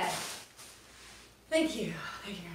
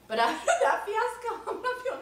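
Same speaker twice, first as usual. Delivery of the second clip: laughing; 14 semitones higher, 6 dB louder than usual. Real, not acted. Laughs at own failed attempt.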